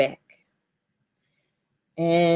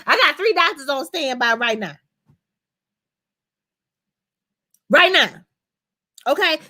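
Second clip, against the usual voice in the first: higher, pushy mock lecture; playing the man laying down the law, lightly; a hint of a worried husband insisting; bossy protective husband; 5 semitones higher than usual. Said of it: "And he was like".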